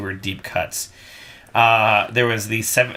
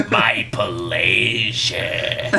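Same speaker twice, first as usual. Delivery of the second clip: "My pleasure" very slow, soft and lower; soft long sexy; 4 semitones lower, much slower than usual. Acted. Manager demanding sexier, drawn-out low reply. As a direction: breathe out "My pleasure" long and seductive. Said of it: "more soft and more long and more sexy".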